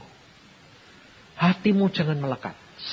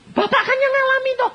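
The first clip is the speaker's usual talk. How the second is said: insistent question; 7 dB louder than usual. Retold or imitated. Pastor voicing pressing visitor.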